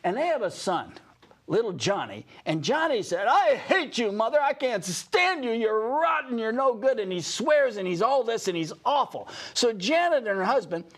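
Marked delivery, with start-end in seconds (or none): 3.3-7.0 high-pitched imitation of a raging son; exaggerated bratty outburst, pitched up; mock angry child. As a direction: throw a hammed-up tantrum at your mother.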